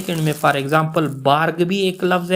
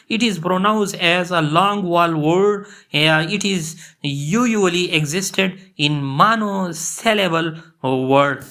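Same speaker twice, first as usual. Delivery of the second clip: reading the rule out word by word, very slowly; slow dictation; much slower than usual. Shown as a demonstration. Teacher to students, reading the rule at dictation pace.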